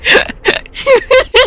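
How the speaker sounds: squealing laughter far up in pitch; helpless high laughter. Real, not acted. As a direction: lose it completely, laughing high. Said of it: "I can't stop laughing".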